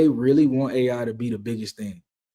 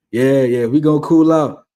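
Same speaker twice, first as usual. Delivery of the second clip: loud insistence; 7 dB louder than usual. Real, not acted. Loud self-calming declaration.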